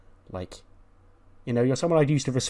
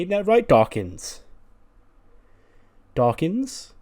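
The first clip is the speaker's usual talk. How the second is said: high, raised voice seeking agreement; acted calling out; 7 semitones higher, 5 dB louder, slower than usual. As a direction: call a friend's name, rising high for an answer. Acts out calling on an unresponsive friend.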